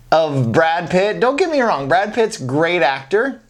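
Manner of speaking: normal talking voice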